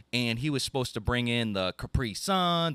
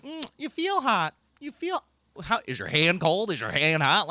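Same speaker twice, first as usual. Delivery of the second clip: mock fussy fever-checker; 7 semitones higher, 4 dB louder than usual. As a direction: play the fussy hand-on-forehead checker, pitched way up. Mocking people checking for fever.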